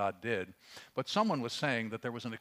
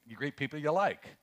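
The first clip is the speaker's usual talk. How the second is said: ordinary talk, nothing marked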